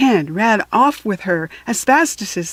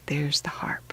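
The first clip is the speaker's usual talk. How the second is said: whispered aside; 6 semitones lower, 12 dB quieter than usual. Real, not acted.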